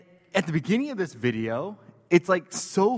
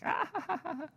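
a much higher, giggly mock baby laugh; imitating baby's laugh; 9 dB quieter, faster than usual. Retelling how his baby sister laughed, high mock giggle. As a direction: squeal out a little sister's giggle. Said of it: "she, like, laughed at him, like".

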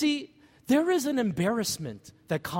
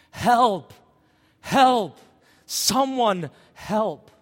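acted cry for help, louder and higher; retold desperate shout; 4 semitones higher, 7 dB louder, much slower than usual. Father calls swimmers to save his drowning daughter, retold desperate shout. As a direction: call out for help in short, forceful bursts. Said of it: "I began to shout shamelessly".

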